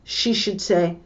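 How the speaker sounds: usual speaking voice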